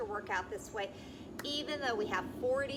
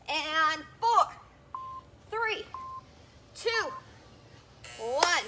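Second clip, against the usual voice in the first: shouted countdown; 4 semitones higher, 6 dB louder, much slower than usual. Real, not acted. Coach counting down final seconds.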